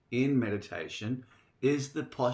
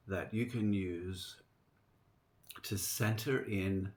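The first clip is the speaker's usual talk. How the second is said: guided meditation; 2 semitones lower, 4 dB quieter than usual. Real, not acted. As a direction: welcome them gently into meditation, unhurried.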